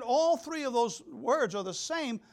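ordinary talk

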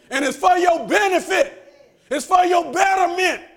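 high, loud sermon cry; full-force preaching. Acted. Preacher promising God has a plan, high shouted preaching. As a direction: proclaim it to the whole church.

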